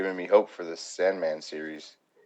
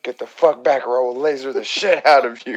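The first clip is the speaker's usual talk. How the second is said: higher, louder villain threat; menacing Homelander; 7 semitones higher, 10 dB louder than usual. Retold or imitated. Fan quoting the villain on the airplane, louder. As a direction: bark the threat like the superhero bully. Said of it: "he's just like".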